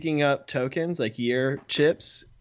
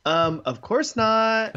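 mock innocent denial; 10 semitones higher, 4 dB louder, slower than usual. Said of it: "And he's like".

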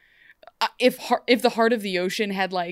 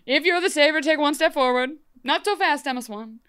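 commanding call; 6 semitones higher, 4 dB louder than usual. Retold or imitated. Court demanding the savior step forward.

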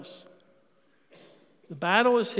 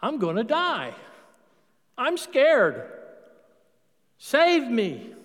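pleading; 4 semitones higher, slower than usual. Acted.

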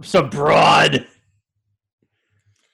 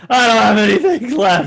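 laughing; 6 semitones higher, 5 dB louder than usual. Real, not acted. Repeats line laughing, louder.

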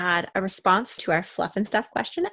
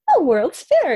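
excited; 9 semitones higher, 6 dB louder, slower than usual. Retold or imitated. Excited realization to husband.

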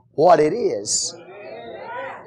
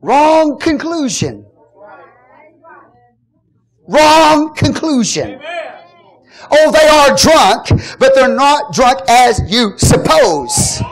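full-force preaching; 7 semitones higher, 4 dB louder than usual. Acted. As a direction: drive the point home to the congregation.

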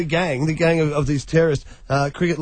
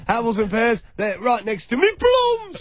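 mock Cockney market seller; 5 semitones higher than usual. Joining the accent joke, a hammed-up Cockney fruit seller. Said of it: "that accent".

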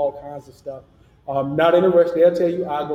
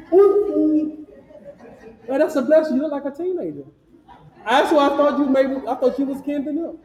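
surprised delight; 10 semitones higher, 3 dB louder than usual. Real, not acted. Surprised to recognize old friend.

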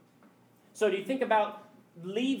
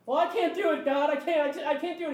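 pleading Moses; 8 semitones higher, 4 dB louder than usual. Acted. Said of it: "Moses refusing God".

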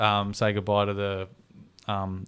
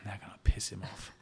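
whispered, acted aside; conspiratorial whisper to oneself; scheming whisper; 12 dB quieter than usual. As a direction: whisper it like a secret plot. Acts daughter's secret scheming thought.

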